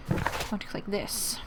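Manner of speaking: normal talking voice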